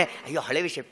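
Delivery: low, quiet mimicry with a chuckle; dismissive mock aside. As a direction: imitate brushing it off, under the voice with a little laugh. Mimics people brushing off old vows.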